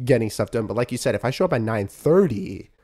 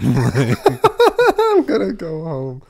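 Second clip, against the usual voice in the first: laughs through the words, drawn out slowly; cracking up while echoing the friend's punchline; laughing; 4 semitones higher, 6 dB louder, much slower than usual. Real, not acted. Repeating her friend's line back while laughing.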